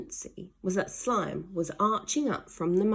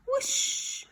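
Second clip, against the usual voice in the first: high breathy whoosh; whoosh effect. A narrator's reading. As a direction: whoosh through the air. Flying sound effect.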